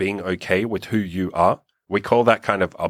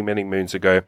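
ordinary talk, nothing marked

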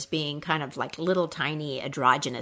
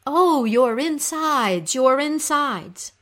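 slow, raised, loud sympathetic lament; echoed moan; 7 semitones higher, 7 dB louder, slower than usual. Acted. Little creatures comforting the suffering queen, echoed moan. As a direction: echo her moan, stretching each word. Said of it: "fly close to her and say".

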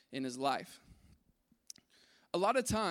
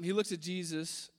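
usual speaking voice

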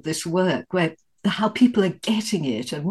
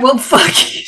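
irritated curse; 5 semitones higher, 7 dB louder than usual. Retold or imitated. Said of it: "And it was like".

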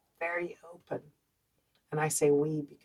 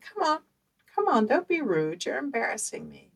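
much higher, louder nagging mother; mother scolding her child to kiss Auntie Caroline, much higher and louder; scolding mom; 8 semitones higher, 4 dB louder than usual. Retold or imitated.

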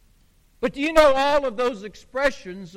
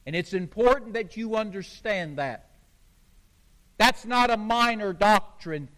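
ordinary delivery

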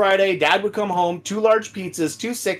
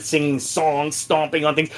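normal talking voice

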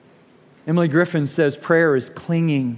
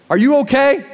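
high and loud call; dad calls out to check on his son; concerned dad; 9 semitones higher, 5 dB louder than usual. Retold or imitated. Dad asking his son if he's hurt, loud and high. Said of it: "And he said".

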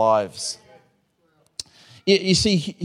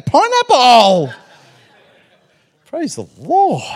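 laughing delight; 8 semitones higher, 6 dB louder, slower than usual. Real, not acted. Laughing at the gift.

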